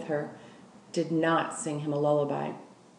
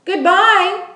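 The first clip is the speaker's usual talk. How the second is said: loud, much higher call; calling louder; 12 semitones higher, 9 dB louder than usual. Acted. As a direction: call after the departing truck. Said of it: "called again, louder this time".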